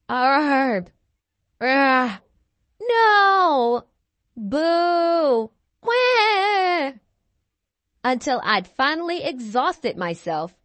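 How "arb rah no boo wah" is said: loud grunt, growl, wail and boo in a row; string of emotional outbursts. Acted. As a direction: cycle loudly through every frustrated feeling. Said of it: "I went through every possible emotion".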